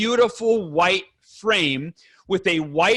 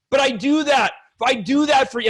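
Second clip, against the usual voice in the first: re-enacted defensive protest, pitched higher; upset, defensive; 6 semitones higher than usual. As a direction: push back like a wronged husband. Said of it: "and I get upset".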